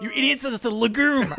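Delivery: high, loud mock outrage; exasperated know-it-all. Host parodying himself correcting people about peanuts, arrogant. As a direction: correct them with hammed-up contempt. Exaggerating his own smug know-it-all act.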